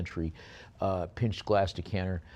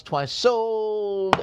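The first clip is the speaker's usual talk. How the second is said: voice cracking; 13 semitones higher, 6 dB louder than usual. Real, not acted. Auctioneer closing the lot as his voice cracks high. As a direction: voice jumps very high and cracks on sold. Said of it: "Is my voice changing?".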